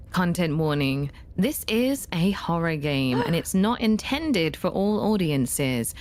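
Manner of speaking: warning read. Acted. Reading the content warning.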